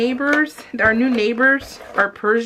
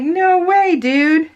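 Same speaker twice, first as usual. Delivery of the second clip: higher, louder no way; mock protest; 7 semitones higher, 5 dB louder, much slower than usual. Turning down husband's cartoon couple idea, high mock protest.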